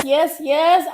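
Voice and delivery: normal talking voice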